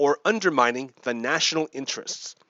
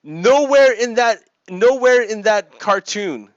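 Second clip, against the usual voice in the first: NOWHERE hit higher and louder, slow; slow, raised, insistent nowhere; emphatic; 5 semitones higher, 6 dB louder, slower than usual. Real, not acted. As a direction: hammer the word nowhere. Emphatic point about the cartoon.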